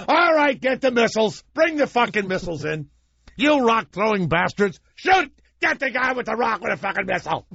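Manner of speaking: high, hammed-up barking orders; mock commander yelling. Commander ordering missiles on rock-thrower, hammed-up yelling. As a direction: rant like a trigger-happy general.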